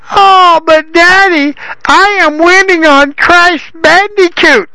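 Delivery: put-on a grown son whining like a child; nasal whiny son. A narrator's reading. Son upstairs whines nasally to his father, pleading. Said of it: "came a nasally response".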